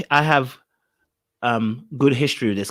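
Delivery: usual speaking voice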